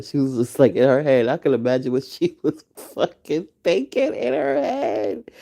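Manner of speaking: ordinary talk, nothing marked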